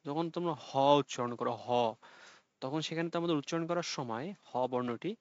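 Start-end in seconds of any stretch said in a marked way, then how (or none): 1.6-1.7 "হ" straight from the windpipe with air released; glottal consonant. Shown as a demonstration. Teacher demonstrates for students, "হ" as a glottal breath sound. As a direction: let air out through the throat on "হ". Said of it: "কণ্ঠনালী দিয়ে সরাসরি উচ্চারণ করা হয়".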